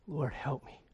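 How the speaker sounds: hushed, humble asking; quiet plea. Acted. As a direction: let the plea fall almost to nothing. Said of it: "she's saying".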